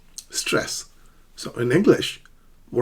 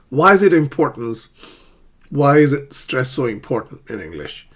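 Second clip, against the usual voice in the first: usual speaking voice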